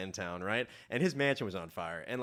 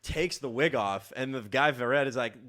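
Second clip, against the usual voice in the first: normal talking voice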